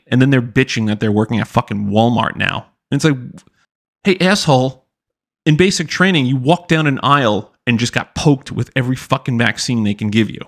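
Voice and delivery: ordinary talk, nothing marked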